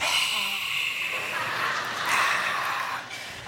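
long breathy sigh of a tired wife; wife's weary sigh. Retold or imitated. Pastor acts wife's reaction to his baby hopes, weary sigh. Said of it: "she's like".

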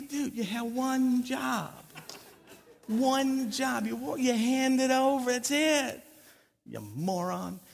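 mock-exasperated scolding, drawn out. Older brother to Mark who bought candy, exasperated. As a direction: stretch each point at a hapless younger brother.

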